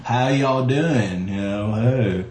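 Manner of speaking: slow, stretched-out Southern greeting; standard Southern accent. Shown as a demonstration. Actor showing the stock fake Southern accent, slow Southern drawl. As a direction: demonstrate a generic Southern drawl, drawn right out. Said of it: "everybody has their standard, you know, Southern".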